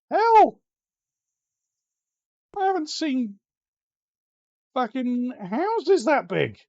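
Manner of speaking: high, loud incredulous yelp; loud stunned disbelief; shocked outburst. Real, not acted. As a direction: shriek it in disbelief. Shocked at huge mansion.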